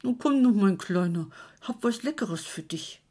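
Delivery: put-on old man cooing to coax his pet rat. A narrator's reading. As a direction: sweet-talk a rat with cheese. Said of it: "mit Säuselton zu locken".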